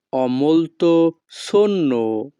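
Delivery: each Italian word spaced out very slowly; slow teaching model. Shown as a demonstration. Teacher models Italian phrase for students, very slow. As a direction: spell it out slowly for learners to copy. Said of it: "Italian ভাষা ho molto sonno".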